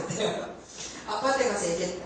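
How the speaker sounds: normal talking voice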